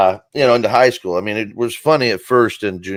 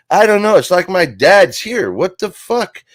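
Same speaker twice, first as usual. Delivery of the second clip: higher, louder, shaken disbelief; rattled; 8 semitones higher, 5 dB louder than usual. Retold or imitated. Telling cousin Tommy he hears dad, rattled and high. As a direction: blurt it out, spooked.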